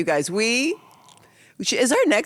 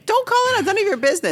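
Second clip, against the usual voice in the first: high, loud insistence; emphatic, worked-up telling-off; heated scolding; 4 dB louder, much faster than usual. Real, not acted. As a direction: scold with rising exasperation, voice way up. Heated scolding about others' business.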